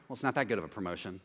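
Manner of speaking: low, fast, grudging brush-off; dismissive fool. Retold or imitated. Jealous friend belittling a promotion, grudging and fast. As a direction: shrug off the good news, sour and quick.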